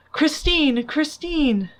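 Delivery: calling Christine in despair; moaning call. A narrator's reading. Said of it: "he moaned".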